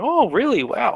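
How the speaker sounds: high, loud, gullible amazement; impressed surprise. Acted. Passerby hearing the merchant's potion pitch, impressed surprise. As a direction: fall for the sales pitch completely.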